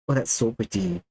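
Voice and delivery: ordinary talk, nothing marked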